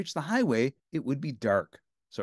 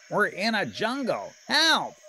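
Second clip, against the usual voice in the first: panicked Bert; 8 semitones higher, slower than usual. A narrator's reading. Bert realising they are in a jungle, panicked cry. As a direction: shout in alarm as Bert.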